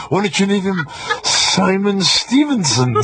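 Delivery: ordinary talk, nothing marked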